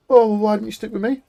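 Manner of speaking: high, put-upon teammate voice; mock whiny complaint. Teammate who got killed, whining high and aggrieved. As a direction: whine it like the sulking teammate.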